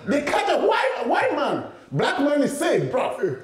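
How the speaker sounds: high laughing outrage; laughing disbelief. Real, not acted. Telling his friend about the arrest, high laughing disbelief.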